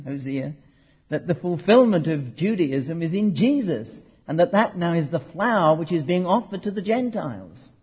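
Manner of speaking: ordinary talk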